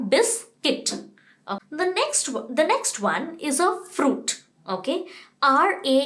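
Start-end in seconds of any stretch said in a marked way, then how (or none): 0.1-0.9 बिस्किट with a short i, kit; right version. Shown as a demonstration. Teacher quizzing pupils, right बिस्किट with short i. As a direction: answer firmly बिस्किट. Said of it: "हम क्या खाते हैं?".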